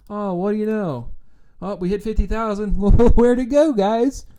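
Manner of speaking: high, taunting fake enthusiasm; sarcastic victory crowing with laughs; mock gloating. Mocks gloating partisans, then their fallen numbers.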